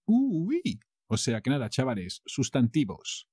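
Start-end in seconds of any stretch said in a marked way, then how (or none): 0.1-0.8 a light [name]-style ooh-wee, much higher; [name]. Retold or imitated. Quoting [name] for fun, a hint of his catchphrase. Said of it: "Like my friend [name] would say".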